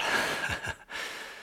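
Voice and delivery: a breathy little chuckle; soft amused chuckle; soft laugh. Real, not acted. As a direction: laugh quietly to yourself. Laughs at his own point.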